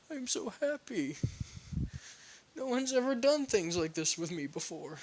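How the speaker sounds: put-on high, teary little-kid voice; Naruto. Child thanking the man who treated him, high teary voice. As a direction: play an overwhelmed small child, pitched high. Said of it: "Naruto tears up a bit".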